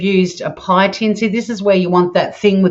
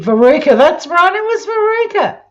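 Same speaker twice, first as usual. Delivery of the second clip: much higher, triumphant recall; excited; 11 semitones higher than usual. Real, not acted. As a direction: shout the name as it comes back. Remembering the name.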